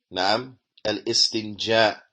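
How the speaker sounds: slow al istinja, every sound clear; careful pronunciation. Shown as a demonstration. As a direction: model al istinja slowly for students. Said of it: "this is how it should be pronounced".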